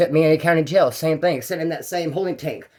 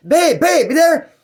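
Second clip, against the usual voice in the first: higher, louder desperate call; calling into the phone; 11 semitones higher, 6 dB louder, slower than usual. Retold or imitated. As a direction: shout for his girlfriend on a bad line. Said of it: "inmate calling girlfriend on phone".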